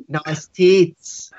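put-on Australian accent, drawn out. Shown as a demonstration. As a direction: give the line your best Australian accent. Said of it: "like, in an Australian accent".

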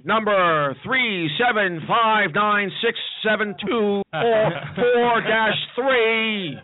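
drawn-out slow number recital; very slow reading. Pretend. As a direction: read it out painfully slowly.

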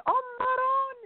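mock the grandmother shrieking in Italian; imitated scream. Retelling grandma's reaction to the movie, high mock scream. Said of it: "in Italian, screaming".

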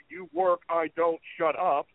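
usual speaking voice

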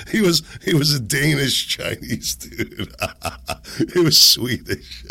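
words breaking into real laughter; laughing. Real, not acted. Host joking about own failed accent, laughing.

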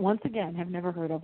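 ordinary delivery

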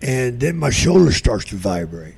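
normal talking voice

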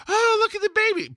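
high-pitched mock gushing lady; gushing stranger. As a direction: imitate a stranger cooing over a baby. Stranger gushes at his baby.

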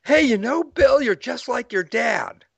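high, bright-eyed mock excitement, slowing on Bill; acting out a sudden eager discovery; excited blurt. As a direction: blurt it out like you just spotted the resemblance. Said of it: "this moment of excitement gets you to say something like".

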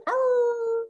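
high howling wolf cry. Pretend. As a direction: throw your head back and howl. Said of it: "Wolf howl".

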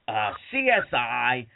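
lightly imitated interviewer, drawn out; Gilbert and Frank Santopadre. Hosts listing the guest's credits, slow stretched delivery. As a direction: slowly reel off credits, each title stretched. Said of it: "Gilbert and Frank Santopadre, they were like".

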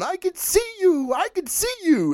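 I can see you, I can see you in a high, taunting villain voice; imitating a giggling villain. Fan imitating the villain's line from the trailer, high and teasing. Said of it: "when he's sitting there giggling, going".

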